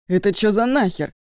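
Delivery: громкий возмущённый окрик друга; резко и громко, на повышенном тоне; возмущённо. A narrator's reading. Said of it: "возмущённый голос друга". (возмущённый выкрик)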